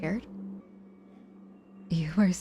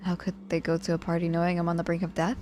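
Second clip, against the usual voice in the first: ordinary talk, nothing marked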